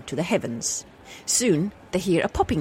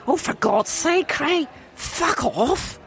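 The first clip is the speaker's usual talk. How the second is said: higher, fuller Scottish swearing; better Scottish accent; 10 semitones higher, slower than usual. Acted. A Scottish wife tells her husband to get lost, in a stronger Scottish accent. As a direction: hang up on him properly Scottish. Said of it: "That's better".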